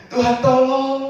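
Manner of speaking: high, loud plea to God; first prayer, still crying out loudly; loud cry for help. Acted. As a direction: call out for help with full force. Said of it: "pertama seru keras".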